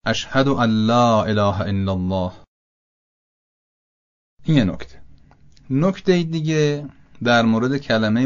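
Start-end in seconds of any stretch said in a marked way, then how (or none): 0.0-2.3 slow, "ان لا" merged into a doubled l; assimilated n. Shown as a demonstration. Teacher showing idgham in the tashahhud. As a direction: say "ان لا" as "اَلّا", nun dropped, lam doubled.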